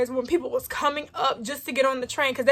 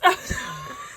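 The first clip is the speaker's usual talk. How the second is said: squealing laugh; 3 dB quieter than usual. Real, not acted. After the insult.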